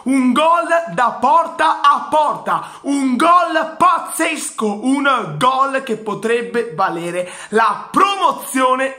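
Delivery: high, ringing commentator call; excited shout. Real, not acted. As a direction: hype the goal to the crowd. Goal hype, shouted high.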